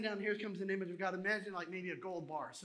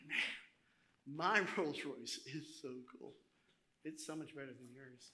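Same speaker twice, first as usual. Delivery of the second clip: low, understated gloating; smug boasting; 5 semitones lower, 5 dB quieter, slower than usual. Acted. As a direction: savor it privately with a little chuckle. Acts out smug car owner boasting.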